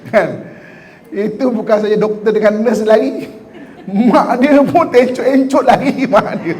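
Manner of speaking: talking through real laughter; laughing. Real, not acted. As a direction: laugh while telling the funny picture. Laughing at newborn baby image.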